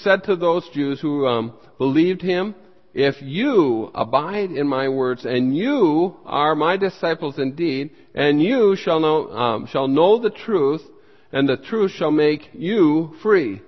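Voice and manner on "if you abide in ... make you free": slow quote with "you" stretched and raised each time; stress on YOU. Shown as a demonstration. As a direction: lean long and hard on the word "you". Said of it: "put emphasis on the word "you".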